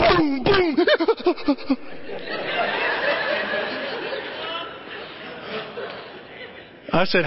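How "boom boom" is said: high mouth-made screech, booms, then breathy trailing puffs; imitated car crash. Shown as a demonstration. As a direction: act out a crash with vocal noises. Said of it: "it went like this".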